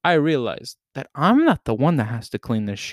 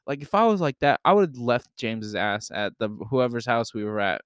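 ordinary delivery

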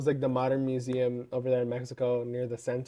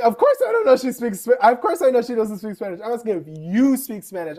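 much higher and louder, indignant; mock exasperated guard; 7 semitones higher, 5 dB louder than usual. The guard correcting her in the retold story, higher louder mock tone. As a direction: huff it out like an irritated guard.

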